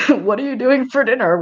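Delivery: lightly mocking echo; mock quote. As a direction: parrot the question back with a laugh in it. Mocking the chat question.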